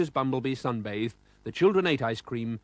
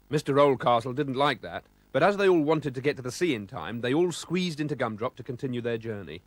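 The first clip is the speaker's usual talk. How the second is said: ordinary delivery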